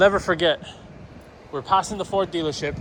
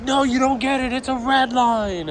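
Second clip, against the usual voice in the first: higher, pleading insistence; insistent friend; 11 semitones higher than usual. Retold or imitated. Friend Carlos insisting to the speaker, higher insistent voice. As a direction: make him see it, voice pitched up. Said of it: "And he's like".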